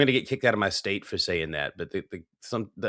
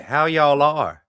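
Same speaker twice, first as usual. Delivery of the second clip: higher, louder, slow Southern drawl; Southern regional accent; 3 semitones higher, 5 dB louder, slower than usual. Shown as a demonstration. Quoting a greeting people mistake for parody, Southern drawl. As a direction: lean on Y'ALL in a Southern accent. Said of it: "think it's a parody when they hear".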